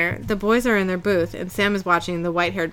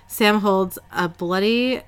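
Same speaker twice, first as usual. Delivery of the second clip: usual speaking voice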